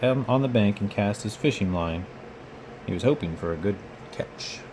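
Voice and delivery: ordinary delivery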